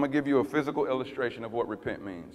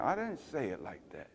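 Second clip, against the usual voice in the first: gently correct, no force; softer, gentle tone; 8 dB quieter than usual. Retold or imitated. The Lord correcting the pastor, quietly. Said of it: "You have the wrong tone".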